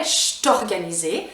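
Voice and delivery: "je t'organisé" slowly, "cht-organisé"; Quebec contraction. Shown as a demonstration. Teacher demonstrating the rule slowly, contracted pronunciation.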